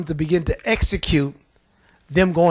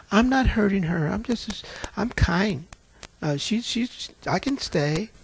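soft, sweet-talking demon voice, quieter; sweet demure demon; 6 dB quieter than usual. Retold or imitated. Demon pleading to stay in the lady, soft and sweet. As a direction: demon playing innocent and gentle. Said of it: "She sounded just as sweet and demure".

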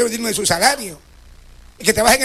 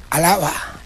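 normal talking voice

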